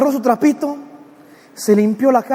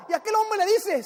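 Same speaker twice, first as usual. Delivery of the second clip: ordinary talk, nothing marked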